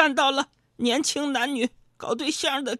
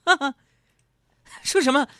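higher, louder put-on voice of a dismissive woman; brisk, raised-pitch brush-off; mocking crush; 4 semitones higher, 4 dB louder than usual. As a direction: imitate the girl laughing off his confession. Dismissive crush answers his confession.

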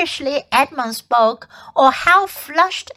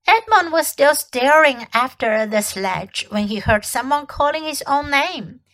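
ordinary delivery, nothing marked

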